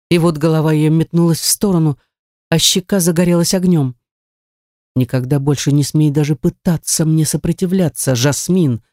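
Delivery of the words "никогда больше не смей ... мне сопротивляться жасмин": ordinary delivery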